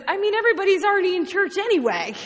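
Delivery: quick, high, cheeky aside; smirking. Retold or imitated. Barney joking about Sunday, smirking and quick. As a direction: toss it off fast with a smirk. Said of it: "With a smirk, he says".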